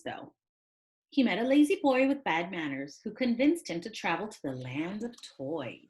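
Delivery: plain reading voice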